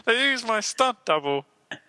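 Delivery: high frog puppet voice; Kermit the Frog. Acted. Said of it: "all right, Kermit".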